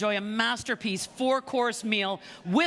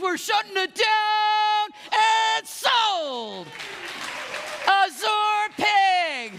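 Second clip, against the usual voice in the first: triumphant shout; 10 semitones higher, 5 dB louder, much slower than usual. Acted. Closing the sale to the winner.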